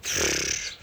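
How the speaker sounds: scoffing breath out; dismissive pfft. Retold or imitated. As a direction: blow out a scoff. Dismissive reaction to far location.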